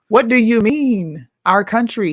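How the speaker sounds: drawn-out sarcastic disbelief; incredulous. Real, not acted. Mocking the politician's quote.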